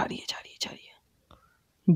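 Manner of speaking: slow hushed whisper. Real, not acted. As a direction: breathe it so the nun won't hear. Hiding as nun leaves. (धीमी फुसफुसाहट में)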